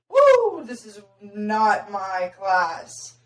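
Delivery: startled woo to the Spanish class, then slow; surprised. Retold or imitated. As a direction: caught off guard and backing out slowly. Realizing she is in the wrong class.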